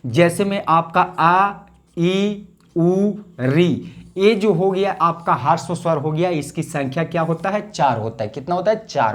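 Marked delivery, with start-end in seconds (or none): 1.0-3.9 आ ई उ री each said short and crisp, slowly spaced; short vowels demonstrated. Shown as a demonstration. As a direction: show the four short vowels. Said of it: "ह्रस्व स्वर की जो संख्या". (ह्रस्व स्वरों का छोटा उच्चारण)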